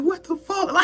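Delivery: squeaky-high disbelief without shouting; retold freaking out. Retelling her first out-of-body scare, freaking out. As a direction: act out panic way up high. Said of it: "I was freaking out".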